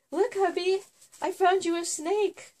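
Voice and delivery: high, sing-song mock-innocent voice; sweet teasing to hubby. Wife presenting a snake to her husband, high and sing-song. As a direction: play it cute, presenting a gift.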